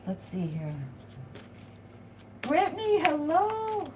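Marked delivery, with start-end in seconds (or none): 2.4-3.9 sing-song greeting. Real, not acted. Greeting a viewer by name.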